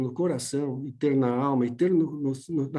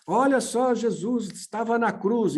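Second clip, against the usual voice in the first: raised higher for emphasis, like bold type; preacher's bold-type emphasis; louder emphasis; 4 semitones higher than usual. Shown as a demonstration. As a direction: lift the voice to stress it. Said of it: "Então, você fala um pouquinho mais alto".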